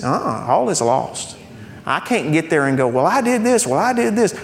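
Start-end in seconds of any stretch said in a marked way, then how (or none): 2.9-4.3 high, pleading little voice claiming credit; mock self-justifying. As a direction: whine out your own good deeds.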